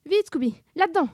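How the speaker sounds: high, loud hurried command; urgent shout. Acted. Sammy sends Scooby into the chest, shouting urgently. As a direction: rush your friend into hiding.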